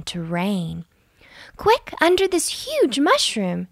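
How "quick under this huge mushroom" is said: a high, urgent call to run; urgent shout. A narrator's reading. Lily shouts to her friends as thunder breaks. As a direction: hurry everyone to shelter. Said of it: "shouted Lily".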